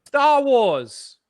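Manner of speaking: loud, high holler; calling out. Real, not acted. Co-host cutting in on talk about Hollywood, calling out. Blurts out film name over co-host.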